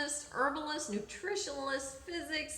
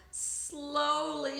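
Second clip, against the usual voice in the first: usual speaking voice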